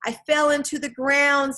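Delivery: high, loud report from below; calling out. A narrator's reading. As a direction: call up out of a hole. Calling up from inside crack.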